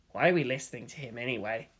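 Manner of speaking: low, soft, quick grumble under the breath; muttered softly. Acted. Writer grumbling to colleague about the lieutenant, soft quick mutter. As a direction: mutter it so only your neighbour hears. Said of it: "someone else muttered softly".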